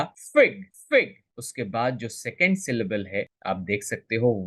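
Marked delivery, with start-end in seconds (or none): 0.0-1.1 "फिग" pitched high, then falling; high first syllable. Shown as a demonstration. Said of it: "इसका pronunciation high होगा".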